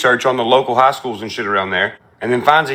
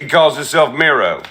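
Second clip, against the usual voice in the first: ordinary talk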